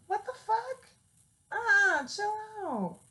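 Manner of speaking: high, startled scolding; scolding the cat. Real, not acted. Owner yelling at her cat to chill out, startled scolding. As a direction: snap at the cat in disbelief.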